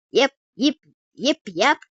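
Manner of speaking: loud, high Texan cowboy yeps; Texan cowboy accent. Acted. Cowboy sheriff sizing up the trouble, loud Texan accent. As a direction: punch each word like a Texan cowboy. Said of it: "in a heavy Texan cowboy accent".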